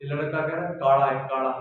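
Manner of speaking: rustic Haryanvi twang on काला; Haryanvi accent. Shown as a demonstration. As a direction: say it the Haryanvi way, काला becoming काड़ा. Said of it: "तो वो कैसे बोलते हैं".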